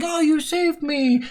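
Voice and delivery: high, slow, grateful little voice; a sweet rescued character breathing thanks; grateful rescued maiden. Retold or imitated. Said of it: "little message saying".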